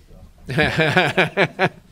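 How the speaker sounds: loud, high chuckle in a run; hearty laugh. Real, not acted. Presenter joking about getting old, laughing. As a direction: laugh out loud at your own joke. Laughs after his own joke.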